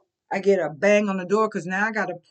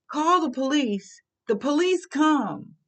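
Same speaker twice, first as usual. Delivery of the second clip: ordinary talk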